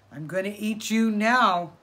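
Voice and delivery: loud furious coyote voice; furious coyote up the tree, loud; furious threat. Acted. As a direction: threaten him loudly, full of fury. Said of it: "for he was very furious".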